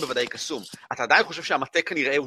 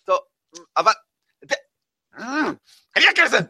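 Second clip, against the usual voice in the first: Yara's quip; 7 semitones higher, 7 dB louder than usual. Acted. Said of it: "והיא כזה".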